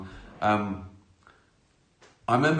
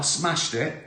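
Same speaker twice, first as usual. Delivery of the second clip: normal talking voice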